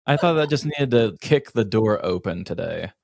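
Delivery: ordinary delivery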